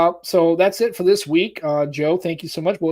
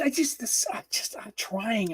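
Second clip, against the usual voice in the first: whispered; 4 semitones higher, 12 dB quieter, slower than usual. Real, not acted. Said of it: "laughing off his bad accent".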